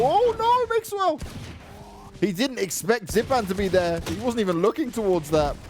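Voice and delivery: high-pitched cry of dismay, then excited call; excited esports commentary, voice pitched right up; shocked play-by-play. Real, not acted. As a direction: call a sudden upset in the match. Shocked live call of a death.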